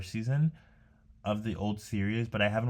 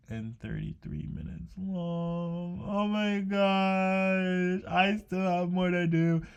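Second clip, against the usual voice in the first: dismayed surprise; 9 semitones higher, much slower than usual. Real, not acted. Dismay at long video length.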